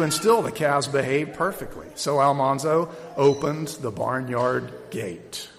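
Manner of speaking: ordinary delivery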